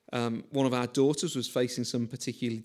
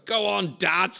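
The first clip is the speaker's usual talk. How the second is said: put-on teen lads yelling; 4 semitones higher, 6 dB louder, slower than usual. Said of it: "yelling after me".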